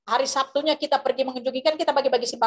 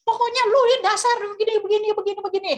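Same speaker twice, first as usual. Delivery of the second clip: angry scolding; 8 semitones higher than usual. Retold or imitated. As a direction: scold him like a furious boss. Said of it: "Dia bilang".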